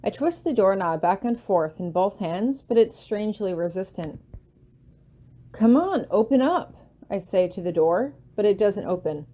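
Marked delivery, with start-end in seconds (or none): 5.5-6.8 urgent plea. A narrator's reading. Pleading with a locked door.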